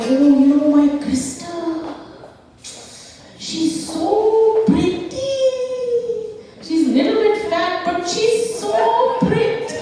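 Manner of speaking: very high, drawn-out gushing; gushing mom impression. Mom praises another girl before daughter, exaggerated high gushing. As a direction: coo the praise slowly in a high mock-mom voice. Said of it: "she would compliment me and insult me".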